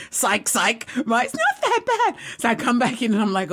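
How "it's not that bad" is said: high squeaky mock reassurance; unconvincing bravado. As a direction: squeal it as if fooling yourself. Repeats her pretended bravery.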